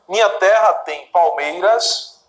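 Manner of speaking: slow, measured poem reading; poem recitation. Shown as a demonstration. Teacher reciting Gonçalves Dias to students, slow and measured. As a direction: recite each line slowly for the class.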